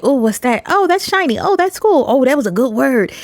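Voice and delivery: high, breezy ooh and aah at something shiny; airy dismissive admirer. Retold or imitated. Mocking shallow reaction to word.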